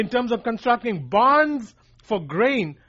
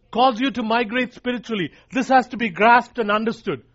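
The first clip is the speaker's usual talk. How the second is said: normal talking voice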